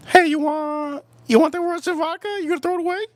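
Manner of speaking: sweet, high and teasing; mock girly voice. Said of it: "They go".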